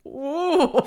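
a high, loud "Ooh" in a put-on African accent; playful African accent "Ooh"; mock African accent. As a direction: echo the hoot in a hammed-up African accent. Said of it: "that was an African accent".

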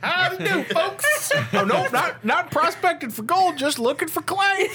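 put-on high-pitched laughing old prospector. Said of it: "Is that an old prospector?".

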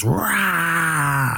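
high mock monster roar; demon roar. Retelling the demon lady's attack, a high mock roar. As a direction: snap out a sudden demon roar.

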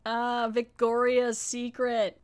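slow emphasis. Shown as a demonstration. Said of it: "repeats the pun slowly".

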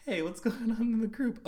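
high, loud mock surprise at herself; playfully acting out finding the group; surprised self. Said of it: "so I'm like".

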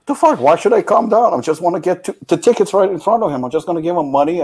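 high-pitched indignant outburst; worked-up, incredulous complaint; angry protest. Retold or imitated. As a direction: re-enact an exasperated argument, voice jumping up. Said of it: "I'm getting fucking angry".

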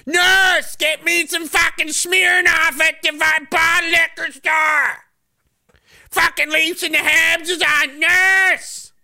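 high, slow demanding patient voice; whiny hospice patient. Pretend. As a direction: play a lazy patient calling nurse.

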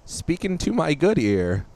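put-on high, slow old-timer voice; old person voice. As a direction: cup your ear and creak it out like a grandpa. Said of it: "I said in an old person voice".

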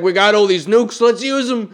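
a gleeful madman with nukes; mock Putin. Mocking Putin's nuclear threats, loud gleeful dictator. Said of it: "It's like".

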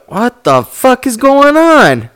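slow, high, drawn-out disbelief; mock bewilderment. High friend rewatching a confusing movie, hammed-up bewilderment. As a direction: stretch it out high, utterly lost.

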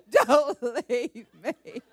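high, loud, slow wailing plea, laughing through it; mock crying plea. Wife waking husband, begging him not to leave, mock sobbing. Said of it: "I would just start crying".

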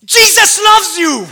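slow, loud and high with laughter; loud laughing shout. Retold or imitated. Pastor shouting to the angry driver, laughing, loud and slow. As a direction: proclaim it laughing. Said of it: "and I go".

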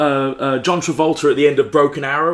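ordinary delivery

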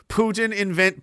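normal talking voice

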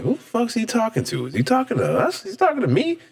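high-pitched, baffled questioning; incredulous. Retold or imitated. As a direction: ask it high, in mounting disbelief. Acted baffled reaction to a drunk.

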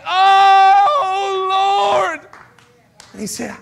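high loud cry to the Lord. Retold or imitated. Turning panic into a cry to the Lord. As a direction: call out with everything. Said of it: "turned it and went".

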